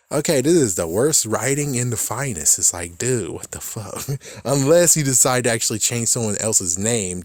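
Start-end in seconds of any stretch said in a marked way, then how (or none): none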